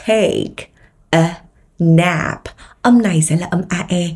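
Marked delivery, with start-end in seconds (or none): 0.1-2.4 TAKE, A, NAP with a pause after each; word by word. Shown as a demonstration. Teacher leads learners reading along, word-by-word TAKE A NAP. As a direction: separate TAKE A NAP into three careful words. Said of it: "Rồi, cùng đọc với [name] ha".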